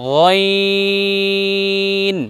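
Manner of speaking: গইন held long from the throat; drawn-out letter. Shown as a demonstration. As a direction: stretch গইন slowly for pupils. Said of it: "আবার বলুন". (গলা থেকে টেনে গইন)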